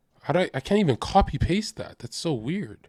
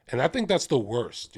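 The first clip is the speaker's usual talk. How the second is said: usual speaking voice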